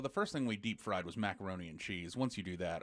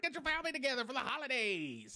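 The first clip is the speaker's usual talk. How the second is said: mock announcer; 14 semitones higher than usual. Parody of the PSA.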